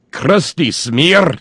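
slow, high Scottish "Crusty smear!" rolled out; hammed-up Scottish growl of "Crusty smear"; Scottish accent. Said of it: "with a Scottish accent".

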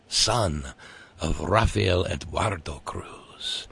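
slow, hushed, rolled Spanish R's; cheesy Spanish accent. Acted. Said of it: "Sorry for the cheesy accent".

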